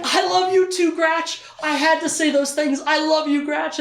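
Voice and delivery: overflowing with relief and love; emotional reunion. Retold or imitated. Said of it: "And Richard's like".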